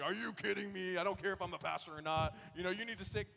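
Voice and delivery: loud imagined tirade; raging. Retold or imitated. Pastor imagining raging at the painter, loud. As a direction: tear into the guy. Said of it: "would have just raged on that guy".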